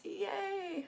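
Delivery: high little cheer; excited squeal. Real, not acted. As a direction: squeal with glee. Cheering the sweatshirt weather.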